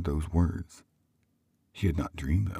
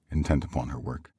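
usual speaking voice

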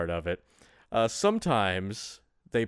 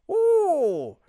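high, loud mock Irish brogue; a bad Irish accent for laughs; hammed-up Irish accent; 12 semitones higher, 5 dB louder than usual. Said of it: "That was my Irish accent".

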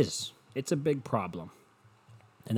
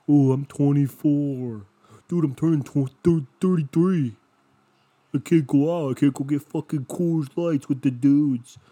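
loud, higher mock whine of a bro; mocking whiny dude; 5 semitones higher, 8 dB louder than usual. Mocking a friend who can't go out, loud bro whine. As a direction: ham up the sulky party guy.

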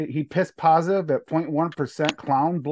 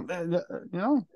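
ordinary delivery

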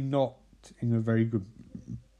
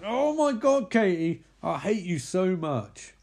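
high mock exasperation, drawn-out ending; mock-exasperated high cry that slows at the end; 7 semitones higher, 3 dB louder than usual. Narrator acting out her reaction to Katie, exasperated.

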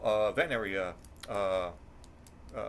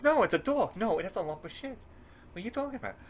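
wife's voice, much higher and insistent; a hint of a defensive wife, raised high; imitating wife; 8 semitones higher, faster than usual. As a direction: pitch up high to play the wife. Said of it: "She goes".

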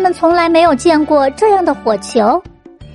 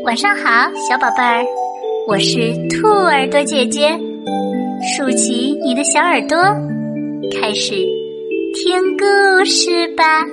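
bedtime host; 4 dB quieter than usual. Acted. Host greets little listeners.

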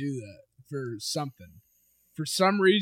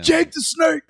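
louder and higher name call; excited shout; 11 semitones higher, 6 dB louder than usual. Real, not acted. Friend excitedly shouting a wrestler's name. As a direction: blurt the name out with glee.

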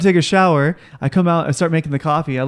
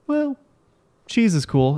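breezy mom; 6 semitones higher, slower than usual. Retold or imitated. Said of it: "Mom was like".

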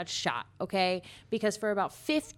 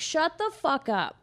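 higher and slow, playful fake anger; mock snap at stranger; 6 semitones higher, 3 dB louder, slower than usual. Imagining telling off a stranger, mock angry. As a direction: drag out each word like you mean it. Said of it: "going up to a stranger being like".

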